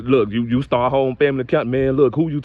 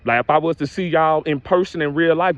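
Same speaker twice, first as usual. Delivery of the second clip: usual speaking voice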